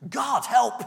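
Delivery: sudden loud high cry, God help; frantic plea shouted at the sky; desperate cry for help. Retold or imitated. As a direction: yell it out in despair. Retold outburst of berserk rage.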